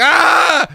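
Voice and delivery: high, loud shriek of terror; horror scream. Pretend. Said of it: "screams in horror".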